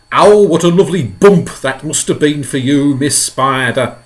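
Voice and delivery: loud, high, cheeky centipede; boisterous centipede. Acted. Centipede teasing Miss Spider about the bump, loud and gleeful. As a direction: crow it out with glee.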